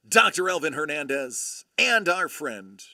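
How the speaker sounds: usual speaking voice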